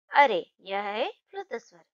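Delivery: usual speaking voice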